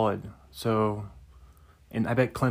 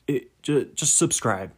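ordinary talk

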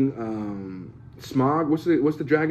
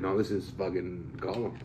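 slow, soft, put-on complaint; exasperated actor; 5 semitones lower, 10 dB quieter than usual. Weary actor grumbling at the tennis ball, slow and soft. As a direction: mutter it slowly, fed up. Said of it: "he's like".

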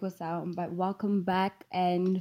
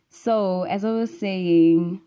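loud, drawn-out words into a bottle; speaking loudly into a bottle; echo voice; 9 dB louder, slower than usual. Real, not acted. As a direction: play with the echo, loud and slow. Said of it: "you know this echo sound".

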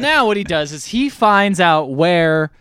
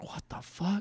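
hushed, higher-pitched mock shock; stunned disbelief; 4 semitones higher, 16 dB quieter than usual. Retelling Dreyfus hearing Bob yell for him, hushed disbelief. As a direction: act out a quiet, stunned double take.

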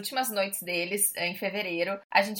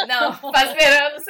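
laughing through the words, slow; giggling while repeating the misheard phrase; 3 semitones higher, 8 dB louder than usual. Real, not acted. Amused friend laughing at the mix-up.

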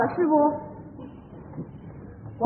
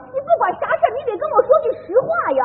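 indignant demand; 7 semitones higher, much faster than usual. Acted. Wife demands honesty in heated quarrel.

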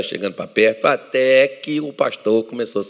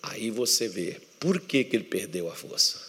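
ordinary talk

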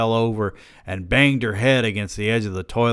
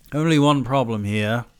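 higher, put-on British voice; British accent; 5 semitones higher, 4 dB louder than usual. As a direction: complain in a light British accent. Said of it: "giving this man a British accent".

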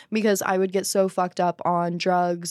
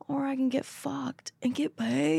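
high, hushed acted-out thought; playful retold brainwave; sly realization; 7 semitones higher, 5 dB quieter than usual. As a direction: pitch it up and soft, like a cheeky idea dawning. Voicing her sly realization.

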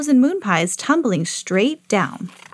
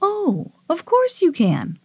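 high, swooping agreeable voice; obliging old moon; 9 semitones higher than usual. A narrator's reading. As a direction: grant it as the kindly moon. Moon kindly grants the request.

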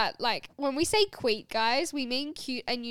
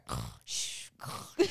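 sleepy mumble; 8 dB quieter, slower than usual. Retold or imitated. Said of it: "but I'd go".

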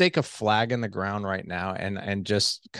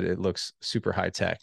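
ordinary talk

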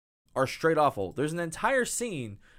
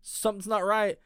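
higher, louder panicky tone, lightly voiced; mock alarmed girl; 7 semitones higher, 5 dB louder than usual. Host imitates the heroine sensing danger, hammed-up alarm. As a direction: play her sudden worry, hammed-up. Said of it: "she's like".

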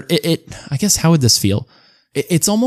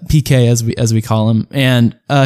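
normal talking voice

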